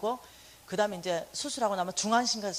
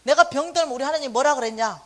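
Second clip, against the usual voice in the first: put-on loud, stubborn old mother insisting; mother's insistence; 3 semitones higher, 8 dB louder than usual. Mother insisting to her son, stubborn and loud.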